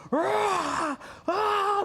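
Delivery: imitating huge crowd laughter; crowd roar. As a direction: bark it like a howling audience.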